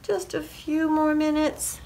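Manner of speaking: high-pitched plea from bed; pleading farmer. A narrator's reading. Farmer bargaining to stay in bed, pleading.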